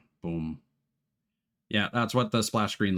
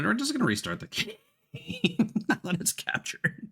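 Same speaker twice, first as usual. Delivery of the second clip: laughing high through the words; amused laugh while talking; 13 semitones higher than usual. Real, not acted. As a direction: giggle it out, half exasperated. Laughs at capture trouble.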